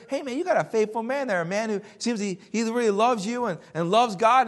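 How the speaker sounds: admiring praise. Retold or imitated. Visitor praising a church member to the preacher, admiring. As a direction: vouch for him with enthusiasm.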